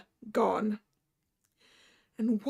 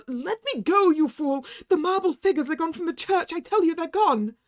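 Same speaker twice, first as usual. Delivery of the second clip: high, loud panicked yell; frantic shout; 6 semitones higher, 5 dB louder than usual. Acted. Terrified narrator shouting at the doctor to let go. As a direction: yell to break free in terror.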